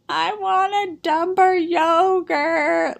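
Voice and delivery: high, drawn-out preschooler wail; over-dramatic whine of a crying four-year-old; mock crying child. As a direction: wail it slowly like a tantruming little kid. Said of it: "he just starts crying".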